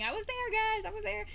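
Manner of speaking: high, eager I was there; excited insistence. Real, not acted. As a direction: bounce up with excitement. Eagerly insists she was present.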